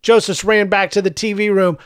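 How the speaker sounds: ordinary delivery, nothing marked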